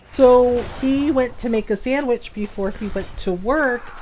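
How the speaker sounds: normal talking voice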